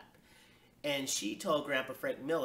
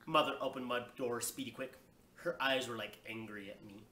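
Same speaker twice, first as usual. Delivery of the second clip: plain reading voice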